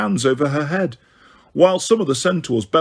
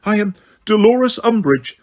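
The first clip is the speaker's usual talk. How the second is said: high, louder frightened voice; high-pitched, terrified; 8 semitones higher, 4 dB louder than usual. A narrator's reading. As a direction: pitch it up in fear. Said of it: "in a high-pitched, terrified voice".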